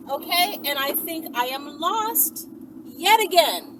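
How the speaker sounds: ordinary delivery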